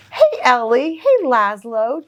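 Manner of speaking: plain reading voice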